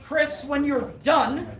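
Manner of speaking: normal talking voice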